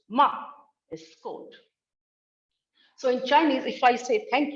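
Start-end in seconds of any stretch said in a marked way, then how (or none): 0.1-0.3 MA dropping sharply in pitch; short MA that falls from high; falling tone. Shown as a demonstration. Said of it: "ma with falling pitch".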